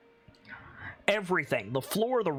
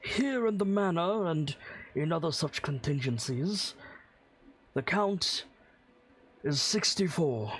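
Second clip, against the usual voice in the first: lightly voiced stiff butler; a hint of a proper old servant; formal butler. Butler Rayburn answers.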